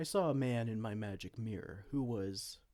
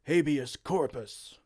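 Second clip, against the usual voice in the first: spell casting; 3 semitones higher, 7 dB louder, slower than usual. Acted. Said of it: "I'll hit you with the very same spell".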